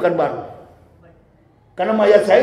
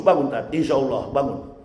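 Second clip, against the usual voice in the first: lower, much softer tender call; gentle waking; 8 semitones lower, 7 dB quieter than usual. Acted. Angel gently waking an upright preacher, soft call. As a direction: wake him as softly as you can. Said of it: "Kepada yang betul-betul lurus".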